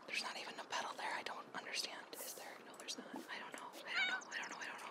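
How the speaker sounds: hushed, breathless whisper of fright; scared whisper. Real, not acted. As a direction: whisper as if afraid to be heard. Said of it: "I'm scared".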